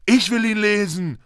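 put-on high, heated boy's demand; furious. A narrator's reading. Boy claiming his own letter, high angry demand. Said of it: "sagte Harry wütend".

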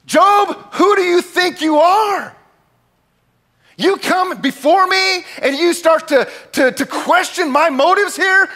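stern rebuke. Acted. God rebukes Job, acted out.

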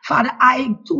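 ordinary talk, nothing marked

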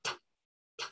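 bare "t" released as a puff, no voice; over-articulate a voiceless "t"; teacher's isolated "t" demo; exaggerated t sound. Shown as a demonstration. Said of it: "Yo lo exagero un poco".

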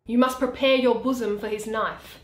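usual speaking voice

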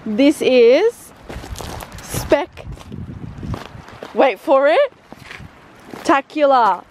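drawn-out spec- then tacular; suspenseful build-up. Pretend. Announcing the view to viewers, teasing suspense. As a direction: pause for suspense mid-word. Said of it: "keeping the suspense going".

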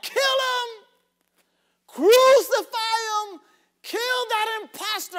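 angry crowd crying for crucifixion, in full voice; crowd shouting. Retold or imitated. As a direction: scream it like the jeering throng. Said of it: "and they're crying out".

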